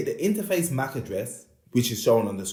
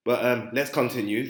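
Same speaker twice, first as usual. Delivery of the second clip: ordinary delivery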